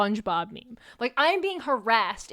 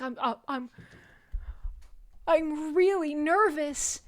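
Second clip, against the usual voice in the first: pretend nervousness; 4 semitones higher than usual. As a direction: stammer into an exaggerated fake-nervous confession. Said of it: "He's pretending to be nervous".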